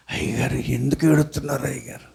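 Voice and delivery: low, hushed worried voice of the youths; timid youths. Retold or imitated. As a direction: ask the pastor in a hushed, nervous hush. Youths timidly ask why he cries.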